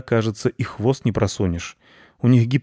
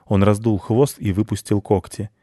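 ordinary delivery

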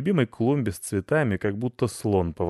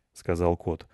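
plain reading voice

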